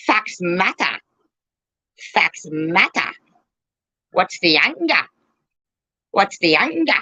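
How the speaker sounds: mock catchphrase voice; mocking mimicry. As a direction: parrot it back as a taunting impression. Mimicking opponents' repeated catchphrases.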